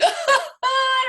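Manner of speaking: loud, high mock sob; hammed-up burst of bawling; imitated crying wail. Re-enacting losing it at her question, loud high wail. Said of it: "crying, and she's like".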